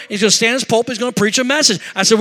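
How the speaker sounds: ordinary delivery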